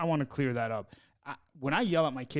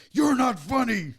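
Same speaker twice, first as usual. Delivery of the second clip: high, loud mocking yell; teasing his friend with a shouted version; 10 semitones higher, 9 dB louder than usual. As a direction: bellow it at the kid. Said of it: "I tell him in a very calm voice".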